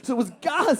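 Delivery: usual speaking voice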